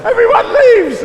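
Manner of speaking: very high, loud mock yell; comic shout. Comedian joking about empty New Zealand, comic shout. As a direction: burst out in a squealed punchline.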